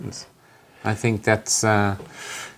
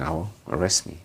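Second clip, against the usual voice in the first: low, soft, unbothered dare; deadpan shrug in the voice; nonchalant retort; 4 semitones lower, 7 dB quieter, faster than usual. Retold or imitated. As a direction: toss it away quietly, couldn't care less. Nonchalant retort to shocked storekeeper.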